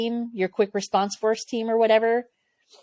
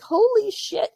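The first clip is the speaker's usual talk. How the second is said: startled, much higher exclamation; alarmed exclaim; 14 semitones higher than usual. Retold or imitated. Crew seeing the QRF race off, alarmed. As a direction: jolt up in alarm.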